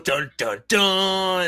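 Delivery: hammy vocal suspense sting; dramatic sting. Acted. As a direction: sing out a cliffhanger cue. Imitated dramatic music sting.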